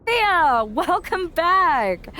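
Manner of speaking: high, drawn-out eager welcome; enthusiastic greeting. Acted. Recruiter greeting returning Rhea with eager, high, slow welcome. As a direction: greet her like you're overjoyed she's back. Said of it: "this enthusiastic greeting".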